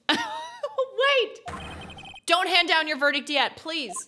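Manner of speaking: high urgent cry of wait, easing into pleading; urgent plea. Acted. Lawyer begging judge to hold the verdict, urgent.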